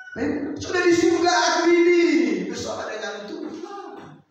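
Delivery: high, loud cry of joy; loud jubilant exclamation; ecstatic shout. Acted. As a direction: burst out with joy. Preacher exclaims heaven.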